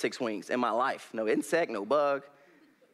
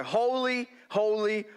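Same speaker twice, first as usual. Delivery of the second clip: crying out; 5 semitones higher, slower than usual. Retold or imitated. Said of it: "they're crying out to each other".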